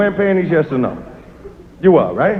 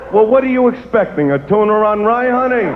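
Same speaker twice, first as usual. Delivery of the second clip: usual speaking voice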